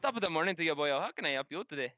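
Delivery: put-on Irish lilt; Irish accent. Gunsmith greets customer, Irish accent. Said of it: "Fucking Irish".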